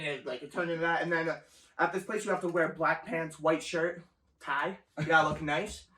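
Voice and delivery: ordinary delivery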